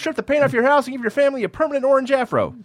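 high, loud, fast character voice; movie quote voice. Acted. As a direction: belt out the movie line. Quoting a movie line.

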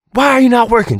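high, loud mock coach outburst; imitated coach yell. Host retells a football coach berating his players, mock angry yell. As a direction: bellow like a coach at halftime. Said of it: "hitting him on the helmet".

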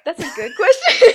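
high, loud, laughing reply. Real, not acted. Daughter caught out on whether she cleans, high laughing voice. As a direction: burst out laughing while answering.

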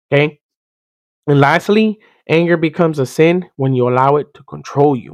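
ordinary talk

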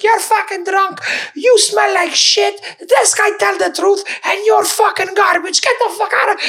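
put-on high, slow, scolding old lady voice; angry old auntie. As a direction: shriek it like a furious old auntie telling off a drunk. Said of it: "she turns around, she's like".

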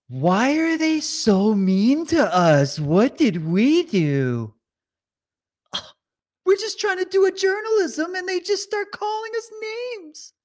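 high, slow whining voice, pitch climbing very high; mock the journalists as whining brats; whiny journalists mocked. Commentator mocking reporters complaining on social media, whiny and high. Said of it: "They go whine like little brats".

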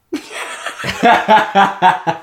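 a short breathy laugh. Real, not acted. Laughing after dumb stuff.

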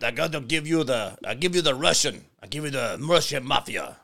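put-on a lightly done Russian mafia accent. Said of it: "I'm gonna have to change my English".

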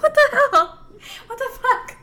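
high, loud, laughing disbelief; laughing shock. Retold or imitated. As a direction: shriek it through laughter. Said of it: "I was like".

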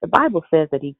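ordinary talk

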